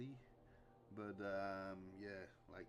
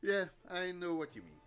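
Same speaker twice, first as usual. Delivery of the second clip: high, loud laughing agreement; loud amused reply; 8 semitones higher, 9 dB louder than usual. Real, not acted. Streamer answering Cookie's joke, loud and amused. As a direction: burst out agreeing.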